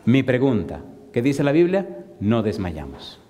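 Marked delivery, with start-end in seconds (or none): none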